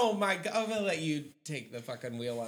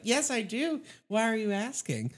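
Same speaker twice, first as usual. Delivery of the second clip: higher, louder put-on woman voice; woman's voice; 7 semitones higher, 4 dB louder than usual. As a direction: sweetly play dumb in a woman's voice. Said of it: "It's my woman voice".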